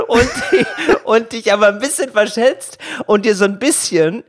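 high laughing voice while telling. Real, not acted. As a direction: talk through giggles. Laughing through a funny story.

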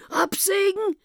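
aghast at the very idea; horrified. Acted. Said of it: "rief der Professor entsetzt". (entsetzter Ausruf)

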